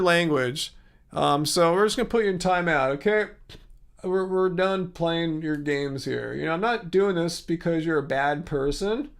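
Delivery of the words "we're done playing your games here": usual speaking voice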